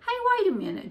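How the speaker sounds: high, loud exclamation; sudden cry. A narrator's reading. Girl stopping her siblings over the newspaper, crying out. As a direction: call out as if to halt everyone. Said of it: "Jessie cried".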